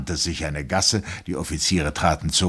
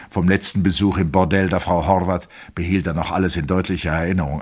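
ordinary delivery

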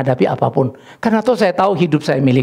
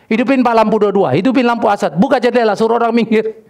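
higher-pitched, rattled-off instructions; urgent orders, retold; 7 semitones higher than usual. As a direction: act out directing the driver urgently. Acting out urgent orders to driver. (menirukan perintah mendesak kepada sopir)

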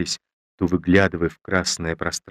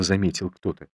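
ordinary delivery, nothing marked

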